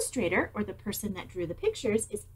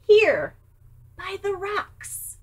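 1 little mouse; 10 semitones higher, 6 dB louder, slower than usual. Acted. Mouse answers.

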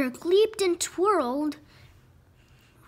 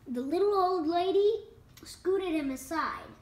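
ordinary delivery

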